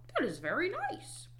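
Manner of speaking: high, approving frog; high storybook Frog; Frog character voice. A narrator's reading. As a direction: coo approval in Frog's high voice. Frog's voice in read-aloud story.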